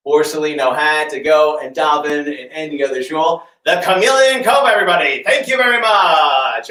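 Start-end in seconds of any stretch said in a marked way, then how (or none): 3.6-6.7 loud, proud sales pitch ending; showman's finale. Acted. Hat magician to audience, loud showman finale. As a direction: sell it like a late-night ad host.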